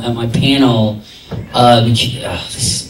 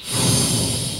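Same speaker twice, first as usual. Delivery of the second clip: long, soft breathy sigh; retold sigh; 11 dB quieter than usual. Actor recalling his reaction to playing many ages, long sigh. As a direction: let out a long quiet exhale.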